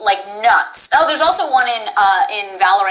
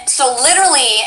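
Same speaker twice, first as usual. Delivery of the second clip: normal talking voice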